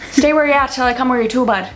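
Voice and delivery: rapid-fire Irish-sounding Newfie accent; Newfoundland accent. Shown as a demonstration. Newfie giving a bud baffling directions, fast Newfoundland accent. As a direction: rattle it off like a Newfoundlander. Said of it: "they have their own language".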